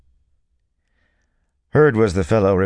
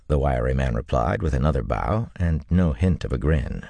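ordinary delivery

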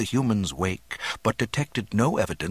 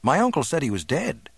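higher-pitched youthful voice; helpful young man; 6 semitones higher than usual. A narrator's reading. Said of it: "Luke supplied helpfully".

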